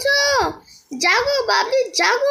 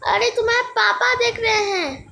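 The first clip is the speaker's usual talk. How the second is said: ordinary delivery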